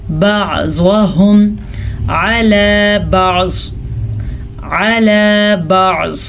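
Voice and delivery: slow, drawn-out tajweed recitation; Quran recitation. Shown as a demonstration. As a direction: chant each word slowly. Recites the verse.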